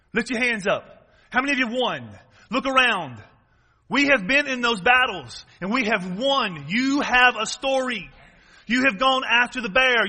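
rally them, driving each phrase home; fervent rallying. Real, not acted. Preacher tells congregation they have won their battles, fervent rallying. Rallies congregation at full force.

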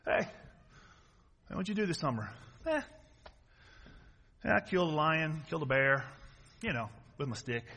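low, soft, offhand mumble; nonchalant schoolboy. Acted. David tells a classmate about his summer, nonchalant schoolboy. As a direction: understate it like it was nothing. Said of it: "David goes to school".

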